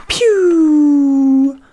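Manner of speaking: loud, high mock arrow pew; pew sound effect. Best friend shooting the dragon, loud high pew. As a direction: fire off a cartoon arrow noise.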